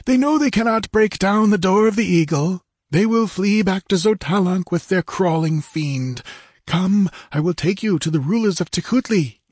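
high-pitched voice of an assured guide; confident character voice. A narrator's reading. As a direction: tell them confidently, pitched well above narration. Narrator voices Techotlal reassuring his companions.

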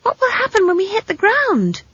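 put-on very high girl's voice; anxious, piping child's question; alarmed child. A narrator's reading. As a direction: ask in high-pitched panic. Frightened child on diving broomstick.

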